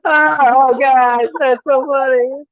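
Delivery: high, loud laughing exclamation. Pretend. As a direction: cry it out through the laughter. Laughing at an imagined phone video.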